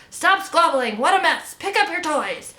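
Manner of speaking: high, loud scolding mother; yelling. A narrator's reading. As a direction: yell crossly at squabbling children, pitched high. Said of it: "he heard her yelling inside".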